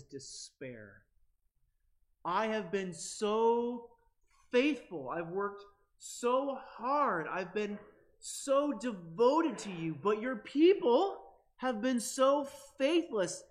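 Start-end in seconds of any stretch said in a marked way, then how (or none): 2.2-13.4 pleading lament. Retold or imitated. Voicing Elijah's lament, pleading.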